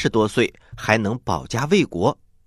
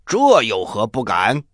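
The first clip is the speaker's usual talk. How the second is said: bold heroic; 4 semitones lower than usual. Acted. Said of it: "慷慨豪迈".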